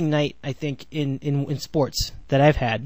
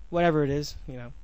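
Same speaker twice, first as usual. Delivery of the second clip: ordinary delivery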